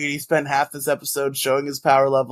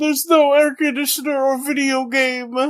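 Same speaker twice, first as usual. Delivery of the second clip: high whiny mock voice, pitched way up; mocking Mo Diggity; 11 semitones higher than usual. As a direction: whine like a spoiled gamer stranded without comforts. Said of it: "That's what Mo Diggity would sound like".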